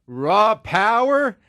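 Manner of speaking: loud, high, drawn-out mock holler; imitating rock veteran. Quoting a surviving band member on the Stooges, hammed-up holler. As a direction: stretch each word into a slow, loud yell. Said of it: "he was just like".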